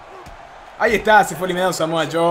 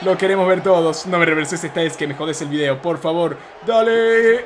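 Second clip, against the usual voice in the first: ordinary talk, nothing marked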